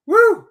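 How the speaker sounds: high, loud whoop; joyful high woo; excited woo. Real, not acted. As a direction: cheer it out big. Excited cheer on livestream.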